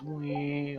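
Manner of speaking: high mock whine of a ringing ear. Telling viewers what he heard after the ear hit, high imitated whine. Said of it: "the whining sound that comes from it".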